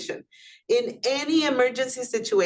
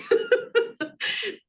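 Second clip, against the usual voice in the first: high burst of laughter. Real, not acted. Teacher laughs in class.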